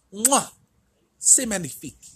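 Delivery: high mock French chef kiss; a hint of French chef flourish. Chef's kiss in promo.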